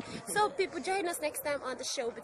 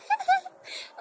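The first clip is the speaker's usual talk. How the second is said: foaming laugh; 8 dB louder than usual. Shown as a demonstration. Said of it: "or you can use the foaming laugh".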